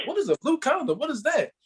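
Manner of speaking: high puzzled question; baffled and high; confused. Retold or imitated. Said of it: "I'm like".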